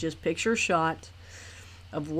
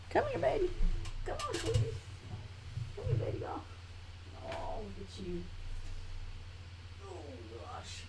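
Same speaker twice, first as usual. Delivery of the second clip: high soft coo to the dog; 7 semitones higher, 11 dB quieter than usual. Real, not acted. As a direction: coax the dog out softly.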